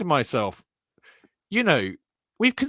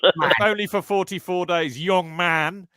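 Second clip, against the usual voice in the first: put-on Yorkshire manager voice, a bit higher; Brian Clough accent; 4 semitones higher, slower than usual. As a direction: lean into the old gaffer's Yorkshire accent. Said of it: "didn't develop the accent that quickly".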